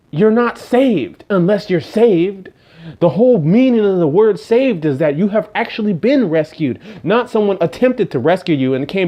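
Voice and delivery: high and loud preacher's drive; preaching at full force. Acted. Preacher driving home the meaning of saved, loud. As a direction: hammer the point home to the flock.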